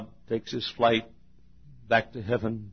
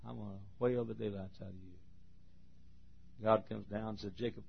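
much softer, low and firm; quiet stern God; 5 semitones lower, 19 dB quieter than usual. Acted. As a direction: say it low, as a calm stern father. God speaking to Jacob.

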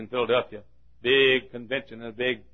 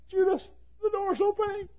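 hushed excitement. Acted. Soul in hell alerting Judas to the door, hushed excitement. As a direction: pitch it high but keep it down.

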